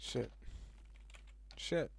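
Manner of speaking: ordinary talk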